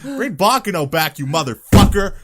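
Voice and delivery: loud, high joking shout; mock angry yell. Fan yelling at the studio head to bring back his show. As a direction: bellow it at the producer in fun.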